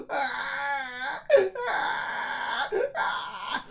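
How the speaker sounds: put-on high crying baby wail; baby howling. Baby woken by crying, howling. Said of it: "the baby started to howl".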